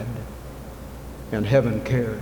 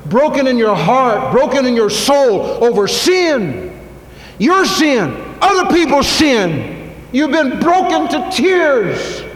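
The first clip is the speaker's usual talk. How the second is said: much higher and louder, slow, nearly shouting; impassioned preaching; 11 semitones higher, 5 dB louder, slower than usual. Acted. Preacher calling the church to repentance, high impassioned shout. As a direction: cry it out, pleading for brokenness.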